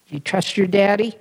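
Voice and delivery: ordinary delivery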